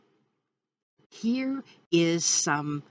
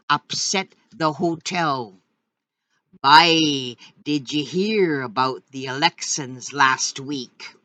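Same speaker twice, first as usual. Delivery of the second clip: put-on reading old Red River dialect yarn, louder, lively storyteller; 5 dB louder than usual. Host reads a 1937 newspaper story in Red River dialect. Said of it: "let's get right in some Red River dialect".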